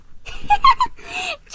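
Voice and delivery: a sudden shrill peal of laughter; burst of high laughter. A narrator's reading. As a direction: explode into giggles at the sight. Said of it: "she burst out laughing".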